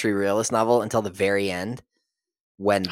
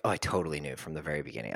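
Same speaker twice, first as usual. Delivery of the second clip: much lower, much softer, fast smug boast; mock smug readers; 8 semitones lower, 12 dB quieter, much faster than usual. Mocking readers who claim they knew, fast, low and soft. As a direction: rattle it off like a bored know-it-all.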